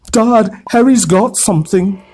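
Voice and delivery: tattling to his father; telling tales. A narrator's reading. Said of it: "said Dudley suddenly".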